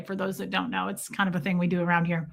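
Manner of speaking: ordinary talk, nothing marked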